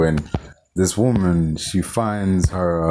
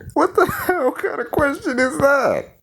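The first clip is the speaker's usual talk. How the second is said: very high, incredulous laughing voice; laughing disbelief. Real, not acted. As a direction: break into laughter while questioning the absurd line.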